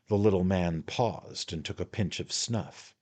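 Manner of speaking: plain reading voice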